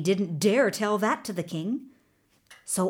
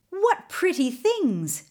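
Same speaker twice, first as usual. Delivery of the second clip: high, slow admiring coo; drawn-out delight, pitch lifted; delighted wonder; 9 semitones higher, slower than usual. A narrator's reading. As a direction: marvel at a lovely find. Delighted at the glittering stones.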